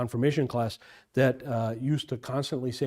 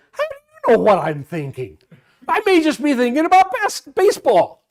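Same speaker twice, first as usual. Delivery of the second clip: higher, louder cheeky retort; smart-aleck kid; 12 semitones higher, 10 dB louder than usual. Retold or imitated. Smart-aleck kid answering the parochial school teacher, cheeky. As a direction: sass the teacher back, laughing. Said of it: "smart aleck kid".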